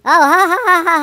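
dry, fake ha-ha-ha; sarcastic laugh. Real, not acted. Mocking a friend's lame pun with sarcastic laughter. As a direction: spell out each ha to mock the joke. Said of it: "hear the sarcasm in my voice".

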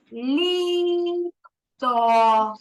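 slow, drawn-out syllables for counting; slow syllable demo. Shown as a demonstration. Teacher showing a child how to count syllables, slowly. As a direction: stretch each syllable so the child feels it. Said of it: "Кладемо свою долоньку під підборіддя".

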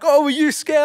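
mock them for being scared; teasing lads. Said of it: "they're like".

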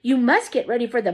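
excited orders. A narrator's reading. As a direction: rattle off the chores in glee. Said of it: "shouted Grandpa Joe".

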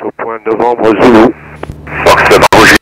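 loud blaring radio call; booming through a saturated headset; over a pilot headset. Real, not acted.

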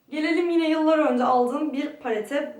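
ordinary talk

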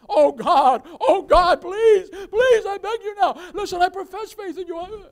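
loud, high, frantic begging; wailed, panicked prayer; desperate pleading. Acted. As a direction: act out sinners pleading with God. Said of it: "they're begging and they're pleading".

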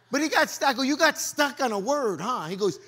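loud fervent preaching. Acted. Pastor warning the church about the devil, loud and fervent.

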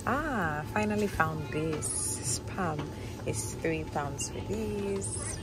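usual speaking voice

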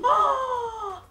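high shocked cry of disbelief; shocked scream. Real, not acted. Watching the aunt strike again, high shocked scream. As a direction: scream like you saw a betrayal.

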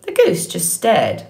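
ordinary delivery, nothing marked

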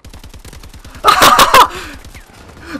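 loud, high burst of laughing; burst of laughter. Real, not acted. Gamer reacting to the match, loud laughter. Laughing at a bad moment in game.

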